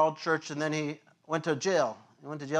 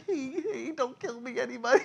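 high, timid plea with a laugh in it; mock scared plea; 9 semitones higher than usual. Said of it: "the pastor's like".

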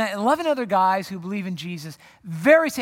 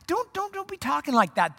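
mock protest; 8 semitones higher than usual. Acted objection to Jesus in prayer.

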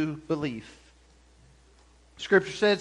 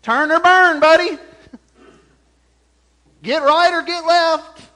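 loud, high, mock-judgmental warning; preachy retort; 8 semitones higher, 9 dB louder than usual. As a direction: throw out clichés at a doubter, hammed-up. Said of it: "All these cliches we throw out".

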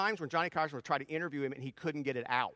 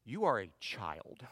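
deadpan wife; 9 semitones lower, 5 dB quieter than usual. Retold or imitated. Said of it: "looks me in the eye and says".

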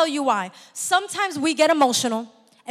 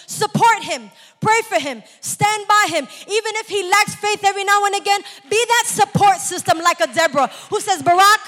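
higher and louder charge to the wives; rising exhortation; 5 semitones higher, 5 dB louder than usual. Real, not acted. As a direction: urge them on, building.

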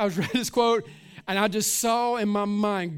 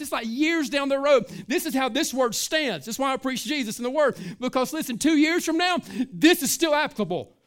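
rising preaching; 4 semitones higher, 4 dB louder than usual. Acted. Preacher rises to full force.

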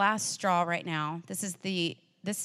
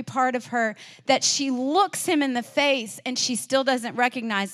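higher, louder sermon delivery; fervent pastor voice; impassioned preaching; 4 semitones higher, 3 dB louder than usual. Acted. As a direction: preach it with building conviction. Preaching with rising fervor.

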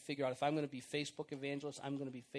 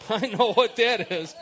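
laughing; 4 semitones higher, 16 dB louder than usual. Real, not acted. Caught out by the word.

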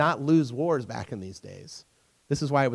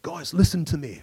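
put-on Scottish brogue; rallying call in a mock Scottish accent. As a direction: imitate a Scottish leader in a Scottish accent. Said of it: "I'll do my Scottish accent".

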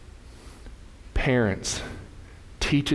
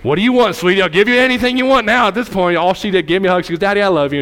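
lively preaching; 9 semitones higher, 8 dB louder, faster than usual. Acted. Preaching retold anecdote.

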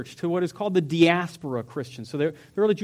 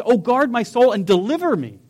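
pleading cry; 4 semitones higher, 7 dB louder than usual. Acted. Preacher reads David's plea.

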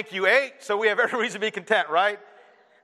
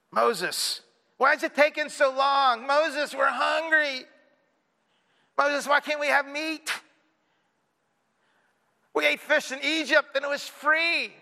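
higher, slow nagging whine at Moses; mock child whine, pitched up and slowed; whining kid; 7 semitones higher, slower than usual. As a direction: drag out the complaint like a bored child. Israelites nagging Moses like kids.

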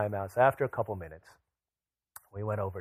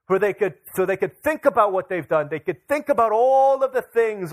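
higher, louder driving sermon cry; impassioned preaching; 6 semitones higher, 6 dB louder than usual. Acted. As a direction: press the point home with rising fervor. Preaching climax on exile, repentance.

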